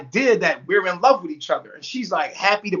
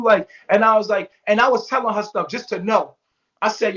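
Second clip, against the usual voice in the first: plain reading voice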